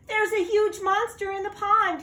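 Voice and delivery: high, loud panicked cry; frightened cry. Acted. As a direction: blurt it out in terror. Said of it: "he cried".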